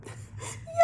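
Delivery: breathy high-pitched giggle; tiny squeaky laugh; high girlish giggle. A narrator's reading. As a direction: giggle like a delighted girl. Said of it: "Emily cracked up".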